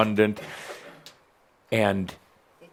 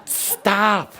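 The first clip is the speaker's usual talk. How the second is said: sharply higher-pitched order; mock exasperated command; 9 semitones higher than usual. Teacher halting the mind's chatter, mock exasperated command. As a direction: halt it with a high, sudden cry.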